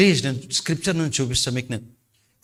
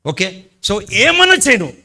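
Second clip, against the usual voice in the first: fervent preaching; 5 semitones higher, 8 dB louder, slower than usual. Acted. Preacher urging loud worship.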